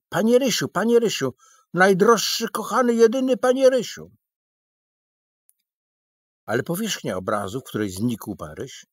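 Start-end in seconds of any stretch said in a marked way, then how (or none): 0.1-4.1 much higher, louder pleading wail; desperate crying out. A narrator's reading. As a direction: call into the painting as if he is lost forever. Crying after the vanished friend.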